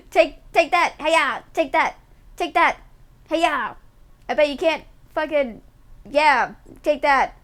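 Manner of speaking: hammed-up kitty attack yells; playful battle cries. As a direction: swat at chat with gleeful cries. Pretend cat attacks chat.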